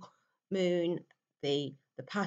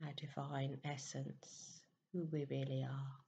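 very soft, unhurried meditation voice with long pauses; guided meditation; 2 semitones lower, 9 dB quieter than usual. Real, not acted. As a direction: gently guide listeners into stillness, hushed and even.

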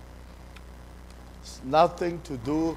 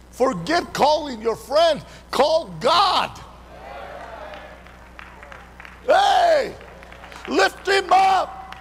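full-force preaching; 9 semitones higher than usual. Acted. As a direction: whip the church up, shouting. Preaching climax with shouts.

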